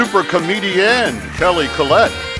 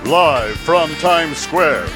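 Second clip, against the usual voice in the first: normal talking voice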